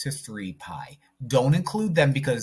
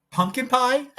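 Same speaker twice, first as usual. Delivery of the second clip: much higher, breaking into a laugh; giggly pun; 12 semitones higher, 3 dB louder than usual. Real, not acted. Teacher joking about pi to the class, giggling high. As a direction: crack yourself up with the pun. Silly pun while checking values.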